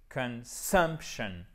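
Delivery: "consumption" very slowly, stress on sump; accent tonique. Shown as a demonstration. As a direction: break "consumption" into syllables, hitting sump. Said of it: "exactement qui est accentuée". (« consumption » très lent, accent sur sump)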